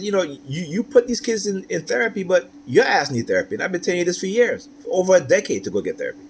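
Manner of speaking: heated retort. Retold or imitated. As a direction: fire it back at her, fed up. Arguing with the children's mother.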